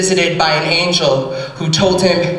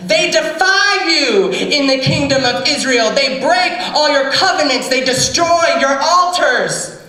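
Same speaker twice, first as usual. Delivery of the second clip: tantrum; 10 semitones higher than usual. Acted. Elijah ranting at the Holy Spirit in a high tantrum. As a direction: throw a whining tantrum at the Spirit. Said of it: "he threw a temper tantrum".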